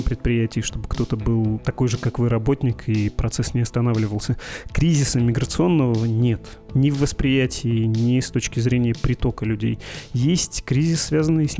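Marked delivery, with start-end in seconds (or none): none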